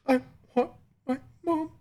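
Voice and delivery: high, squeaky imitated child whimper; pathetic sad cry. Three-year-old after being yelled at, imitated pathetic cry. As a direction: mimic a tearful little kid, very high. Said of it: "pathetic, sad cry".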